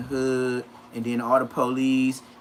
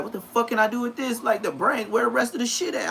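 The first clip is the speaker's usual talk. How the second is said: dude's confused complaint; 6 semitones higher than usual. Retold or imitated. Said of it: "dude there used to be like".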